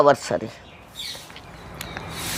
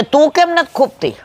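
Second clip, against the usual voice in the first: lion asking; 8 semitones higher than usual. Acted. Lion asks the cow.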